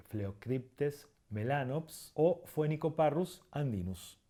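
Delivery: "Phleocryptes" and "Phoenicoparrus" slowly with initial f. Shown as a demonstration. Teacher giving examples of P-H, slow f in "Phoenicoparrus". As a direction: say "Phleocryptes" as fle-o-KRIP-tes, unhurried. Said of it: "P H que se pronuncia con, como F".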